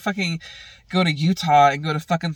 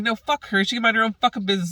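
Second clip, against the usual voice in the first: angry venting; 7 semitones higher, much faster than usual. Retold or imitated. Retells angry venting about Stella.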